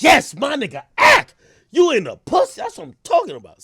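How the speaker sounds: high, loud hyped-up shout; cheering fans. Acted. His audience egging him on, excited cheering. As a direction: whoop it up as a hammed-up fan.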